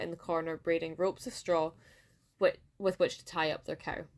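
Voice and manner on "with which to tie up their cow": ordinary delivery, nothing marked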